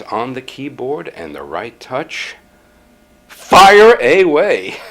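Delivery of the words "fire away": loud, high, long-drawn call; slow, triumphant yell; exultant shout. Acted. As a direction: belt it out with each word stretched. Climax of typing fantasy.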